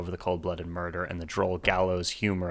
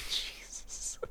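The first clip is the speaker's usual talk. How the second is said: whispered aside; 12 dB quieter than usual. Real, not acted. Shocked by lyrics.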